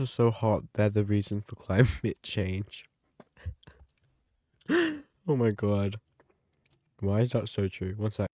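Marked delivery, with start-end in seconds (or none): none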